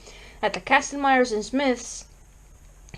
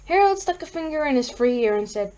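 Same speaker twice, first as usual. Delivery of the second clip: plain reading voice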